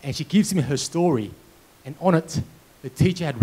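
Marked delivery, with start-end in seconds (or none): none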